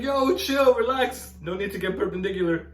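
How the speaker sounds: hammed-up calm-down bro; cool guy. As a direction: wave her down, too cool. Calming angry yoga teacher.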